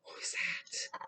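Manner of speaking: hushed, secretive whisper. Acted. Mystery moment in picture book.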